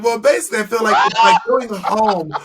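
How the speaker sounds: high, loud mock diva voice; campy drag queen. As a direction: ham up a pageant queen answer. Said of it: "you'd be like".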